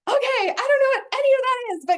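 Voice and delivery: fast, high, breathless confusion; bewildered squeal. Retold or imitated. Replying to her coach, high and fast in bewilderment. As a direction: gush out quickly, way up high and lost.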